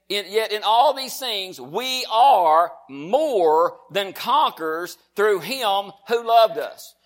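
slow, triumphant pulpit proclamation; emphatic, drawn-out preacher's declaration; declaimed scripture. Acted. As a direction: stretch and punch each word of the promise.

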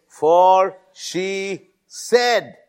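very slow, each word set apart; measured, spaced-out preaching emphasis; deliberate verse reading. Real, not acted.

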